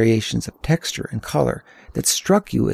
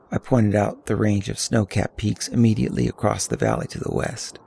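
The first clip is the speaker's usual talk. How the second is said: ordinary delivery, nothing marked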